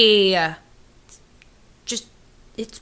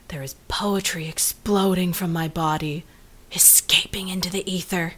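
rapt whisper; 4 semitones lower, 8 dB quieter than usual. Acted. Swooning over writing poetry.

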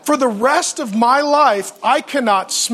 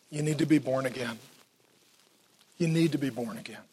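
soft earnest Jesus; 7 semitones lower, 10 dB quieter than usual. Retold or imitated. Jesus speaking to Nicodemus, softly.

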